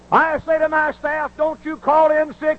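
high, slow, punched pulpit line; emphatic preaching. Acted. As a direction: drive the slogan home slowly.

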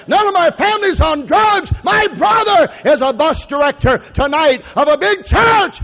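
high, loud joyful proclaiming; excited testimony. Retold or imitated. Preacher friend rejoicing over his family, loud and high. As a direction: proclaim it with rising joy.

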